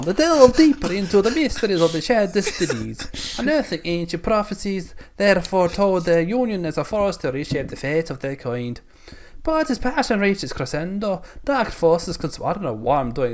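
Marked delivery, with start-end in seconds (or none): 0.0-8.8 higher, comic Italian storytelling; hammed-up Italian narrator, pitched up; mock Italian accent. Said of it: "It went Italian". 9.4-12.4 higher, hammed-up Scottish narration; theatrical Scottish storyteller; mock Scottish accent. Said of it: "then I went back to Scottish again".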